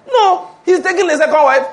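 high and loud, laughing through it; laughing as he delivers the punchline. Real, not acted. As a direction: burst out laughing at the joke.

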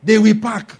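THEY punched loud in "They will pack."; "They" 를 크게 강조하며 반복. Shown as a demonstration. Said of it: "repeated phrase".

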